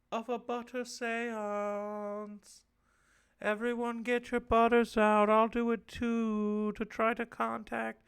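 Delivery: high, slow, drawn-out chant; mock seance incantation. Host calls everyone to a butter seance, slow mock incantation. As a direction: summon a spirit, stretching every word out.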